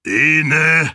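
loud, high, rough booming call; hoarse roar of greeting. A narrator's reading. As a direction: bellow it out like a huge man. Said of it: "eine dröhnende Stimme".

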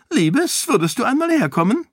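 high, quick sugary call; a fussing queen summoning her dear; sweet queen voice. A narrator's reading. Queen calling Sarene over, high and sweet.

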